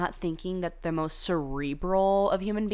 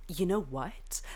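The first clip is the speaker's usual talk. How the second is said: whispered aside; 5 dB quieter, slower than usual. Real, not acted. Aside to viewers.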